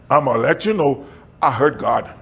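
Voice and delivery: ordinary talk, nothing marked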